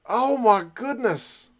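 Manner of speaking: slow, high, drawn-out amazement; amazed. Real, not acted. Fan still reeling from the try, slow astonished exclaim. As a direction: let the disbelief hang on every word.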